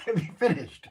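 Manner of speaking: ordinary talk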